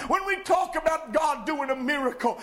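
loud, high shouted preaching; preaching at full force. Acted. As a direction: drive it home like a fiery preacher. Pastor exhorts congregation.

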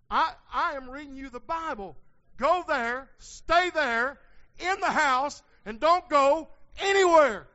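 loud, high preaching, building to a shout; full-force preaching. Real, not acted. Preacher charging the congregation to stay in the house, shouted preaching. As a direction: hammer each short phrase at full volume.

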